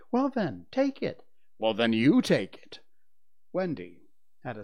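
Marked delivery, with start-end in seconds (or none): 0.1-1.2 put-on high, louder little boy; high-pitched childish taunt; child's challenge. A narrator's reading. Child challenges father in quarrel. 1.6-2.8 loud comeback, stress on you; father's loud squabbling retort; retorting challenge. A narrator's reading. Father throws the challenge back.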